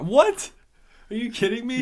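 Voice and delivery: very high, loud "what?"; hammed-up shock with a soaring rising pitch; outraged disbelief. Acts out his outraged reaction.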